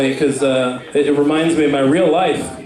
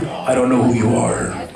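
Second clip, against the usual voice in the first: Liam Neeson; 4 semitones lower than usual. Pretend. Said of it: "Liam Neeson from Taken".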